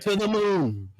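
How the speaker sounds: lightly voiced Pops, excited; hammed-up Pops. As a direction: shout it like a giddy old dad. Continuing the Pops impression.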